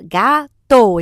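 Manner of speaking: "Gato" broken into GA, TO; split into syllables. Shown as a demonstration. Clapping game with a child, "Gato" split into syllables. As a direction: say "Gato" in two separate beats, ga - to. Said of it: "falar uma palavra em pedacinhos".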